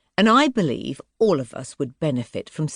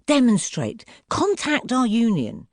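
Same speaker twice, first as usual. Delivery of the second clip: urgent; 10 semitones higher, 3 dB louder than usual. A narrator's reading. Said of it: "Fiona hisses".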